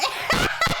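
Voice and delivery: sudden high-pitched laugh; high burst of laughter. Real, not acted. AI attempts Swiss accent.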